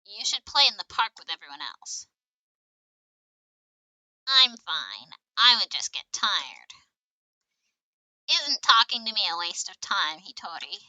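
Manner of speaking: loud, projected character acting; children in game dialogue. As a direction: play the scene big and loud, line by line. Voices children in game scene.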